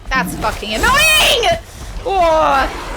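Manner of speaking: loud, high, drawn-out angry yell; frustrated shout. Real, not acted. Spotted by enemy in game.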